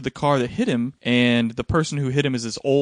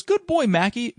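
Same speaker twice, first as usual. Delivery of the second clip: lightly voiced daughter, higher pitch; a hint of a child praising the pet, pitched up; 9 semitones higher, slower than usual. Daughter praising the family dog, lightly imitated child. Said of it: "the daughter goes".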